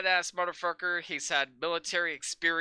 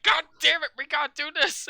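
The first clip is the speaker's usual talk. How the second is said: lightly voiced exasperated villain, higher and louder; mock bad guy; 9 semitones higher, 7 dB louder than usual. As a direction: imitate a fired-up bad guy. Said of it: "the bad guy's like".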